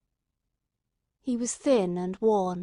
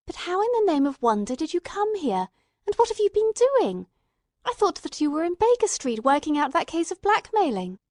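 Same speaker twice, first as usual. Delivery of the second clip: astonished; 8 semitones higher, 4 dB louder than usual. Acted. Watson demands explanation.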